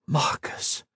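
breathless gasp of a name. A narrator's reading. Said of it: "gasped Ethan".

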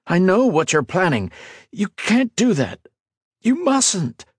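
high, mounting desperation; pleading. A narrator's reading. Ethan races beside her to stop her, pleading. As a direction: plead with rising urgency. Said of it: "Ethan pleaded".

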